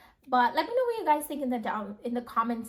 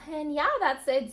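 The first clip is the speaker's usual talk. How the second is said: breathless after a sigh; winded, catching her breath; 5 semitones higher, 4 dB louder than usual. Real, not acted. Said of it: "Sorry, I'm running out of breath".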